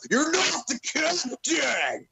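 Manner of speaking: huge high-pitched roar, words stretched out slowly; Braun Strowman yell. Acted. Podcaster imitates wrestler yelling about the captain, drawn-out impression shout. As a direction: bellow it like an enraged wrestler. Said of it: "get to hear Braun Strowman yell".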